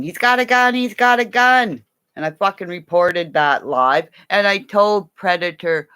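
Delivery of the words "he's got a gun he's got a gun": high panicked yell; high-pitched alarmed cry of a gun; mock yelling. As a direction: shout it in alarm, over and over.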